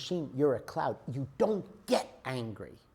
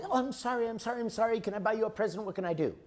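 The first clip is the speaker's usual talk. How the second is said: higher, pleading, flustered apologies; scared apologizing daddy; 7 semitones higher, much faster than usual. Retold or imitated. Father apologizing to his crying wife, higher and pleading. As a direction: play the frightened husband begging forgiveness. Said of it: "and daddy".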